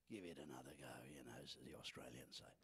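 very soft, low whisper; whispered aside. Real, not acted. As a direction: drop right down to a whisper.

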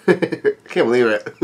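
slow, soft words through a laugh; laughing disbelief. Real, not acted. Fan laughing in disbelief at the reveal, slow and soft. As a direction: chuckle it out in disbelief.

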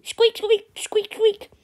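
high squeaky squeaks, like a small animal; squirrel squeak effect. Pretend. Faking the squirrel's noises for the video, high squeaks. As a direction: squeak it out high like a tiny rodent. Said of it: "doing these sound effects all on my own".

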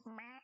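short, drawn-out mock meow; imitated cat yowl. Streamer mimicking the cat rushing to protect her, imitated yowl. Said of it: "he's like".

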